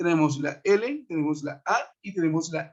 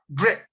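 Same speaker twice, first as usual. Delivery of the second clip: "gret" said alone as the stressed syllable; stressed last syllable; 3 semitones lower, 3 dB louder than usual. Shown as a demonstration. Teacher showing students which syllable carries the accent, stressed "gret". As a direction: isolate "gret" and give it the stress. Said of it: "está en la última sílaba".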